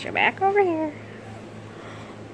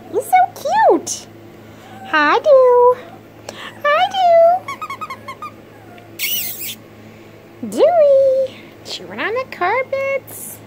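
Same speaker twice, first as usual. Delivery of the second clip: high sing-song pet talk, much higher; baby talk to a pet; 7 dB louder than usual. Acted. Owner greeting the puppy, high cooing baby talk.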